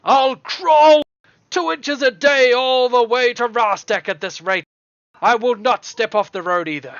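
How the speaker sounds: loud, high defiant bellow; full-voiced roar, pitched well up; angry dwarf shout. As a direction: shout stubbornly across the road as a dwarf. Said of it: "And he shouts".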